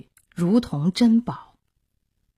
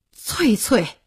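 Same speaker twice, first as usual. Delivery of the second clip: higher, heartfelt call of her name; emotional cry; 4 semitones higher than usual. Acted. Jinding leaping up at the sight of his wife Cuicui, crying out. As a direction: cry out to your long-lost wife. Husband leaps up seeing lost wife.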